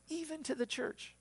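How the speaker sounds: "Even to the church" lifted high; emphatic stress. Shown as a demonstration. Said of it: "There's an emphasis there".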